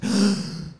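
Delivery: a quick sharp gasp of strain. Retold or imitated. Strain under the squat, sharp gasp. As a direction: gasp as the bar is caught. Acting out relief under the bar.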